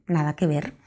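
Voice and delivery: usual speaking voice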